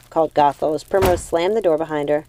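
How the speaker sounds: plain reading voice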